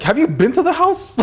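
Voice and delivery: high-pitched mock disbelief; incredulous. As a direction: question the kid in playful amazement. Incredulous reply to scared nephew.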